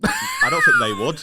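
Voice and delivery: high, slow squeaky protest; squeaky retort. Real, not acted. Retorting to his co-host, high and slow. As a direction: squeak it out slowly.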